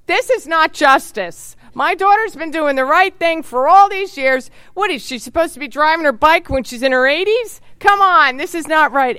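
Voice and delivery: mom's outraged plea, peaking on come on; imitating her mother. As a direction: protest like a fed-up mother writing the governor. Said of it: "loves when I do imitations of my mother".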